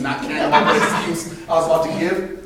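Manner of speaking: ordinary talk, nothing marked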